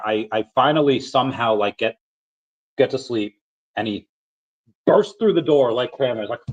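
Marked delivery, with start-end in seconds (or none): none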